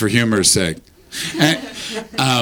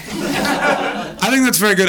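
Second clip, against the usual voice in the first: laughing; 10 semitones higher, slower than usual. Real, not acted. High laughing remark.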